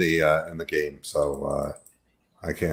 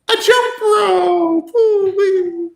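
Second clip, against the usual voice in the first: high, drawn-out trumpeting cry; elephant impression; much slower than usual. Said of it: "This is my elephant impression".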